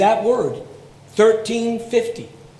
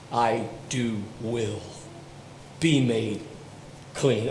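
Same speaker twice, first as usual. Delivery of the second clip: tender words of Jesus; 8 semitones lower, 10 dB quieter, slower than usual. Retold or imitated. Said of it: "and he says".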